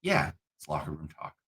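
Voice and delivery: mock smirking agreement; smug dismissal. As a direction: toss it off softly, as if with a knowing smirk. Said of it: "who kinda smirked and nodded".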